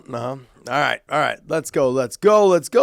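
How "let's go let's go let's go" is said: loud building hype chant; rally the crowd; hype call. Real, not acted. Hyping up the next fight.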